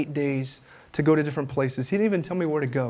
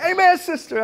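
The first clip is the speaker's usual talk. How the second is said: mock amen; 13 semitones higher, 5 dB louder than usual. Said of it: "I was like".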